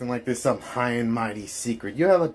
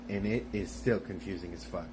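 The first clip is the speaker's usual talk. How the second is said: ordinary talk, nothing marked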